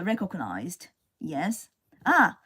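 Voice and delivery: ordinary talk, nothing marked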